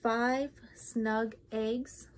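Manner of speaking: ordinary delivery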